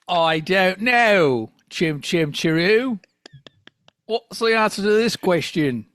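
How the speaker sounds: slow, drawn-out mock Cockney; fake British accent. Fake Biff stalls on a joke question, slow mock British accent. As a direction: stretch it out in a put-on London accent. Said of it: "I don't know what accent I'm doing now".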